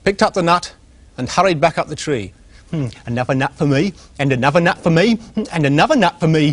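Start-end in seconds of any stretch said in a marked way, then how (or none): 2.7-6.5 greedy squirrel giggling. Acted. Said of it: "he giggled".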